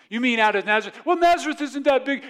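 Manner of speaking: high, loud mock bickering; arguing. Preacher mimics someone arguing over unnecessary points, loud bickering. Acted petty argument over Nazareth.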